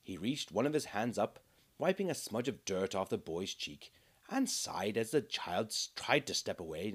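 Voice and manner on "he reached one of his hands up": ordinary delivery, nothing marked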